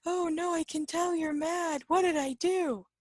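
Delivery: high-pitched, pleading friend imitation; worried companion. Companion insists you are mad, high pleading mimicry. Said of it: "the person with you goes".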